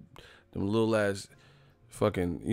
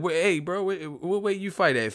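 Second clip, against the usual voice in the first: pitched up high, a hyped-up bro asking; mock fighter voice; 7 semitones higher than usual. Boxer asks another fighter's weight, pitched-up mock bro. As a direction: play the eager boxer checking weight.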